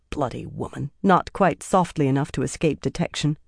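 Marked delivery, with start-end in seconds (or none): 0.1-0.9 soft, low grumble; much softer, a half-hidden aside; muttered. A narrator's reading. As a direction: mutter it under your breath. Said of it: "snorted with laughter and muttered".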